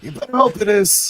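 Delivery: high, loud hollered retort; shouted comeback. Acted. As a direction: yell back the joke in character. In-character shouted comeback to jibe.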